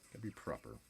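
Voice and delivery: quiet mock British accent aside; soft British accent. Repeating the line to himself in a soft mock British accent. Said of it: "Very British".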